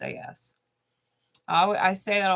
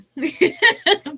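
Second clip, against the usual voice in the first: burst of laughter; 12 semitones higher, 6 dB louder than usual. Real, not acted. Laughing at the card.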